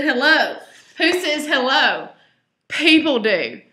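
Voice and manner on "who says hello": plain reading voice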